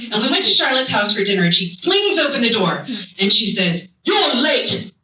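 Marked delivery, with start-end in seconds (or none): 4.0-4.9 put-on old-timey accent, higher-pitched scolding; Charlotte. Hostess flings open the door to late guests, old-timey scolding. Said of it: "has an old-timey accent".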